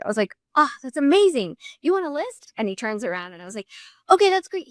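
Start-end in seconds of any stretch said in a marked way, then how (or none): 0.6-2.5 excited surprise. Retold or imitated. Excited retold reply. 4.1-4.7 excited. Retold or imitated. Excited retold phone call.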